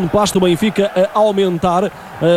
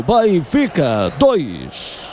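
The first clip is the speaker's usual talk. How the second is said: very slow stretched score; drawn-out score call; 2 semitones lower, much slower than usual. Real, not acted.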